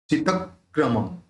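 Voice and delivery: चितक्रमम as "chitak-kramam"; आधा क जोड़ कर. Shown as a demonstration. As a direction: hold the doubled k in चितक्रमम. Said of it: "आधे क को त के साथ जोड़". (चितक्रमम में क पर रुक कर)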